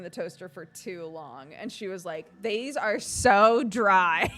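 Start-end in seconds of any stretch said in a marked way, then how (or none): 2.4-4.3 loud, drawn-out mock wail; hammed-up complaint. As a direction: whine it slowly and loudly, half laughing. Retold child's complaint, hammed up.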